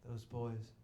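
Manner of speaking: low, soft, grave; softly, a hint of stage gravity; hushed solemn line. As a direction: say it like the final tragic reveal. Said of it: "he says".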